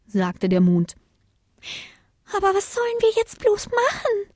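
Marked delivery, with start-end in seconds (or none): 2.4-4.3 sehr hohe, schnelle Kinderstimme; winziges Sternchen. A narrator's reading. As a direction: frag ganz klein und schüchtern. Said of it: "fragte das kleinste Sternchen". (sehr hohes Stimmchen)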